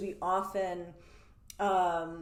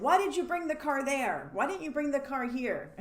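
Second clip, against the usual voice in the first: pushy mock-accusing why, pitched up and louder; accusing question; 7 semitones higher, 4 dB louder than usual. Client grilling a partner about the car, accusing. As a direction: grill the partner as if they got it wrong.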